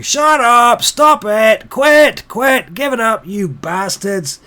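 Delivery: high, very loud heckling; yelled, outraged jeering; angry shouting. Acted. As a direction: shout them down like a fed-up tavern crowd. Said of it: "they start shouting".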